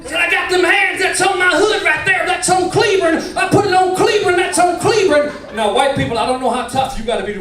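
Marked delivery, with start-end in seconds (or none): 0.2-5.3 high, shouted cowboy threat; cowboy twang. Retold or imitated. Cowboy snapping after being bumped, shouted cowboy twang. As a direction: snap in a loud pitched-up cowboy accent. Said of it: "He was a cowboy".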